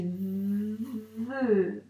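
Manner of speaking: "Ve" with a very long v gliding into held e; sounded-out syllable. Shown as a demonstration. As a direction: buzz through the v of "Ve" as if tracing it. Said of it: "donc on doit le dire, les sons".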